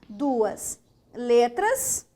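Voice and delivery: Duas letras said very slowly; slow emphasis. Shown as a demonstration. Teacher repeats the rule slowly for the class. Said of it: "duas letras e um som".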